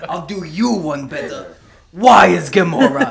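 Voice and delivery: slow, put-on comic accent, building to a loud punchline; Drax accent. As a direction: stretch it out in a mock accent, booming the question. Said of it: "fun little accent you put on Drax".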